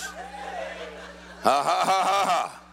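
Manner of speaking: long string of deliberate ha-has; triumphant laugh. Shown as a demonstration. Preacher models laughing at the devil for congregation, deliberate triumphant laugh. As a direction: laugh it out on purpose. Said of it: "That is the appropriate response".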